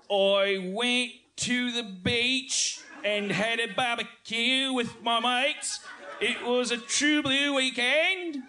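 high, slow hammed-up Australian accent; mock Australian accent. John in the Australian dialogue, hammed-up high Australian accent. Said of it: "I'll be John".

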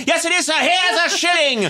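high, excited Victorian voice; eager, high mock-British exclaiming; Dickensian send-up. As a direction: play a Christmas tale at full ham. Plays out Christmas Carol scene.

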